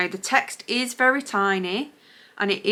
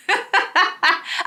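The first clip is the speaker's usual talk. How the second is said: amused laugh; 10 semitones higher than usual. Real, not acted. Laughing at her own misreading.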